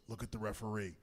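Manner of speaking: low, hushed prompt; sly aside. Retold or imitated. Owen to his opponent mid-match, discreet aside. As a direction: tip off your opponent under your breath.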